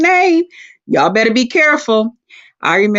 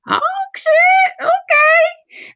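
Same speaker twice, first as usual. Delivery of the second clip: shrill-high sobbing cry of a daughter; much slower than usual. Retold or imitated. Daughter crying out in alarm in the retold feud, high sobbing shout.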